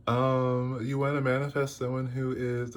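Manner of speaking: usual speaking voice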